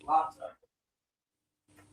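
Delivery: faint and distant, off-mic; calling from the next room; from another room. Real, not acted. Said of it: "Can't hear you".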